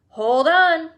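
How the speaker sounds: loud, high warning cry. A narrator's reading. Mr Wonka warning his passengers, loud high cry. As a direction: yell it as the lift launches. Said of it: "cried Mister Wonka".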